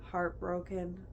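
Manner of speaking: a sad drawn-out heartbroken. Real, not acted. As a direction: stretch it out, aching. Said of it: "I was just, like, so".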